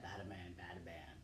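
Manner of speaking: a quiet low mumble as he bobs along; soft low chant; low muttering. A narrator's reading. Iguana's muttered walking sound.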